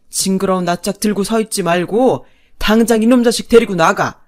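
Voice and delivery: loud, blustering rage; father-in-law's angry outburst. Acted. Father berating his son and wife, bursting with anger. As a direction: roar at your son and wife. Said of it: "아버님이 버럭 소리쳤죠".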